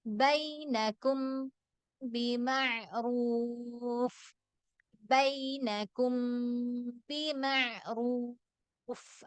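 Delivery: ബിമഅറൂഫ് with a light, unforced meem, no lip pressure; slow chanted ബൈനക്കും ബിമഅറൂഫ്, lips relaxed; drawn-out ബിമഅറൂഫ് ending in a breathy ഫ്; correct unstressed recitation. Shown as a demonstration. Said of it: "അങ്ങനെ പറയേണ്ട ആവശ്യമില്ല".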